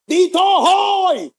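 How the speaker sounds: slow, high, loud shout to get his attention. Retold or imitated. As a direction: yell it out, drawn out. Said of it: "sabi niya".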